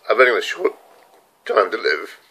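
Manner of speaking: tearful, slowed, breaking voice; fighting back tears; crying. Real, not acted. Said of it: "Bloody good cry".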